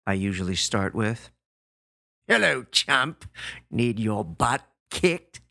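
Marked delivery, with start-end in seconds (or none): 2.3-5.4 smug gruff bully, slow; taunting tough guy on the phone; gorilla voice. Retold or imitated. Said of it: "I can hear him smiling over the phone".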